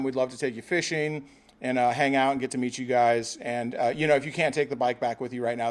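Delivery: normal talking voice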